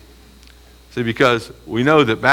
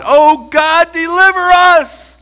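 loud, much higher plea, slow on deliver us; crying out to God; 8 dB louder, much slower than usual. Acted. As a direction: cry out for rescue. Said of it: "they should be crying out to God".

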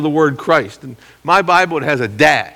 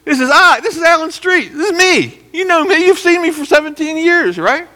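much higher, louder earnest plea; earnest self-defense; 4 dB louder than usual. Retold or imitated. Pastor defending himself before his congregation, high and pleading. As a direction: insist they know you, pleading hard. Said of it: "I said".

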